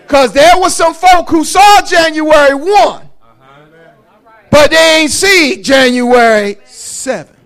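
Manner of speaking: loud, high preaching. Acted. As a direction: build it up and preach. Preaching at full force.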